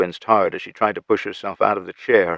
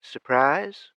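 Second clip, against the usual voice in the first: dry, mocking question, a bit higher; sardonic; 7 semitones higher, 4 dB quieter than usual. A narrator's reading. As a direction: say it with a wry, sardonic edge. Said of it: "Tara tried in a sardonic tone".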